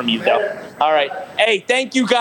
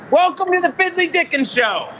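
much higher, big show-host call; hyped announcer demo; 11 semitones higher than usual. Shown as a demonstration. Host showing the guest how to announce, hyped. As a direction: sell it like opening a live show. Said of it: "Now, what we gotta do is".

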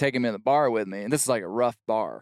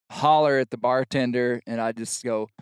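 ordinary talk, nothing marked

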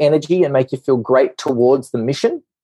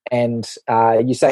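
ordinary talk

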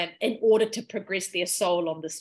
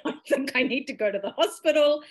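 higher, laugh breaking into a retold plea; amused mock distress; laughing retelling; 4 semitones higher than usual. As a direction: laugh while quoting your own panic.

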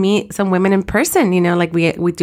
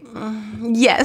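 blissful sigh; 2 semitones higher, 3 dB quieter than usual. Retold or imitated. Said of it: "I was like".

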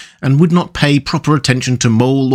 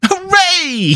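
very high, joyful whoop; hammed-up storybook cheer; excited cry. A narrator's reading. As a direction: cry out in delight, pitch leaping up. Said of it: "he cried".

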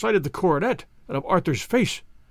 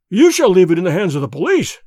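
loud, furious threat; acted outburst, much louder; half-mad with rage; 8 dB louder than usual. A narrator's reading. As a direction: cry it out in grief and rage. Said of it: "I cried half mad with grief and rage".

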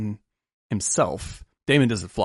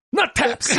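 much higher shouted Boston nut taps; mock Boston clerk yelling it back; Boston Dunkin' clerk. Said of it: "Especially when he does the".

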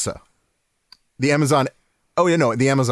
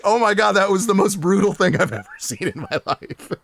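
laughing; 6 semitones higher than usual. Retold or imitated. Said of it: "I was just laughing 'cause I was like".

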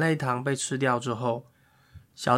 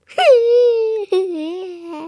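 wailing cry sound; 7 dB louder than usual. Acted. Fake crying noise.

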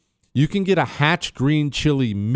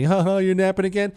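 higher-pitched mock jeer at a friend; mocking teasing; 7 semitones higher than usual. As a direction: lightly imitate colleagues poking fun. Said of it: "always poke fun of me".

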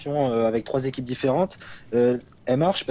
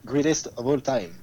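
showy, careful English accent. Real, not acted. Guest spelling out GOAT for the table, English accent. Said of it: "avec ton accent anglais".

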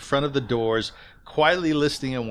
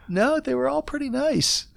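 higher, light mock reply; lightly mimicking the guest; 14 semitones higher than usual. Host mimicking the guest's stock answer to interviewers, lightly higher. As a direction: toss off the guest's polite answer as a light high imitation. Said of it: "Your answer was".

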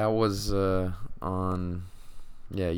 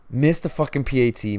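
louder, higher angry rant; frustrated outburst; 5 semitones higher, 5 dB louder than usual. Real, not acted. Fan venting to his viewers about a missed kick, angry outburst. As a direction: spit it out in frustration.

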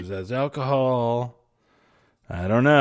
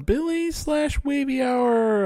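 drawn-out high announcer call; slow, sing-song show opener; show intro; slower than usual. Real, not acted. Opening the live show.

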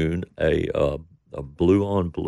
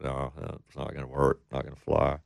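retold dismay; 5 semitones lower, 9 dB quieter than usual. Couple's private reaction to the song.

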